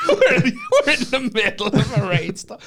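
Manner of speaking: hammed-up drunk echo. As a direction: bellow it like a bladdered bloke. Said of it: "So, so he goes".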